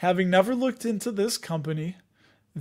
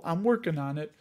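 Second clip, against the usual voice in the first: normal talking voice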